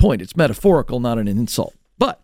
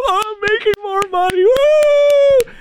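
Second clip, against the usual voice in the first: very high, put-on gleeful squeal; mock elation; slower than usual. Workers celebrating getting paid more, mock elation. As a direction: celebrate in a silly high voice, ending on a whoop. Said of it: "when you get paid more, we all go".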